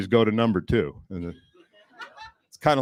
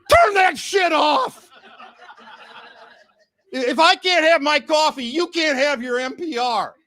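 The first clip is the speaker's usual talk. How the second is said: much higher, louder, slow yell; hammed-up angry shout; mock furious rant; 6 dB louder than usual. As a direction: yell it like an outraged passenger. Ranting at the driver.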